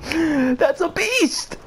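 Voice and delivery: high, drawn-out amazed voice; awed. Real, not acted. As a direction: marvel at it slowly. Awed at the huge bear.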